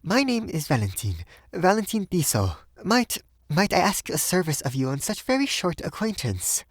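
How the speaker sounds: high, hesitant woman's voice; grave request in a high voice; grave young Frenchwoman. A narrator's reading. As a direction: introduce yourself high, then falter into a request. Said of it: "grave and a little pale".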